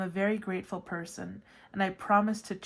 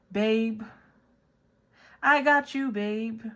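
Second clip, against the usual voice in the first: voicing God; 3 semitones higher, 5 dB louder, slower than usual. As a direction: play God as a cool boyfriend. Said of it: "And God tells me".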